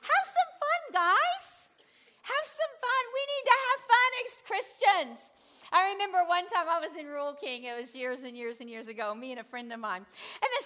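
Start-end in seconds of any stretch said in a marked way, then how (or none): 0.0-5.3 much higher, playful urging; playful excitement. Real, not acted. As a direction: lift them up with fun. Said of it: "Jesus is fun".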